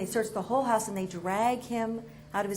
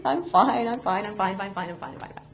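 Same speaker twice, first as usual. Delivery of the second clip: unconvincing fine. Retold or imitated. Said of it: "I was like".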